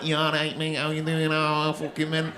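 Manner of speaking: lightly hammed-up Manchester lad accent; broad Manchester accent. Comic mocking Manchester locals in a broad Manchester accent. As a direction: toss it off like a cheery Mancunian. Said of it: "they'll sound like the Manchester Bee".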